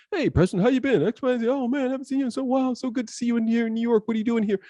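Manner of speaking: high-pitched, hurried imitation of a friend; chattering friend. Friend greeting him while his mind is elsewhere, high background chatter. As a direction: prattle on in a high put-on voice.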